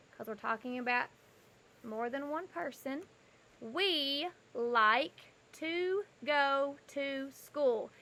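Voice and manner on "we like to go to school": teacher reading sentence word by word; slow reading aloud. Shown as a demonstration. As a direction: read the sentence slowly for the class. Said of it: "this sentence says".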